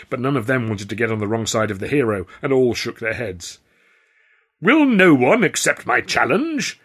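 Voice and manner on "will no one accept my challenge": high, loud, drawn-out clown's showman call; theatrical plea, slowly proclaimed; desperate cry. A narrator's reading. Clown challenges the waiting crowd, desperate cry. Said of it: "Whimsical Walker cried in desperation".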